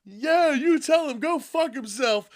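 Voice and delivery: high, loud, hyped-up cheering; mock excited cheer. John Cena cheering Cody on, high and excited. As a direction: pump him up like a hype man. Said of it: "And John".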